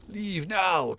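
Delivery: loud, high commanding shout; angry guardian spirit. Acted. Guardian spirit expelling a trespasser, angry loud command. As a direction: order them out at full voice.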